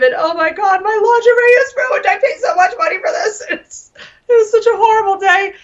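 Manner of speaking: high, laughing through mock tears; laugh-crying. Real, not acted. Recalling ruined lingerie to host, laughing and wailing. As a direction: laugh and wail at once.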